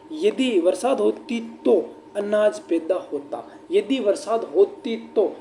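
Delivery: slow dictation. Shown as a demonstration. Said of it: "यानी कि साथियों बात तो भूतकाल की".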